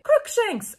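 high mock battle cry; shouted spell. A narrator's reading. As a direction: hurl the word at the villain. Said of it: "I shouted at him".

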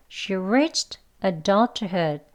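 each word drawn out and spaced; slow. Shown as a demonstration. Teacher modelling the sentence slowly for learners. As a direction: read it out very slowly. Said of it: "천천히 해볼게요".

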